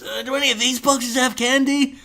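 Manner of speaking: high-pitched, very excited character voice; imitating an overexcited Milhouse. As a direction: squeal the question with eager excitement. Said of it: "Milhouse very excitedly says".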